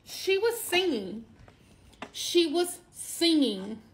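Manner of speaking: high, slow, awed repeat; breathless amazement at her niece singing; marvelling. Real, not acted. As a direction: say it in wonder, drawn out.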